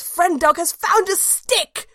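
high, thrilled yelp of a word; excited cry. Acted. Dog narrator spots a stick, bursts out excited.

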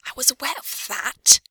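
sharp hissed retort; hissed back. Acted. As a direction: hiss it back at him. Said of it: "she hissed back".

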